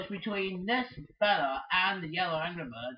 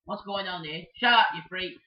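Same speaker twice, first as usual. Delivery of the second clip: ordinary talk, nothing marked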